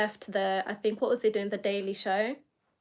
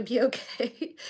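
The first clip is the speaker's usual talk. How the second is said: real giggle breaking into the words; laughing through it for no clear reason; 3 semitones higher than usual. Real, not acted. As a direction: let a laugh slip into the reassurance. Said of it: "I don't know why I'm laughing".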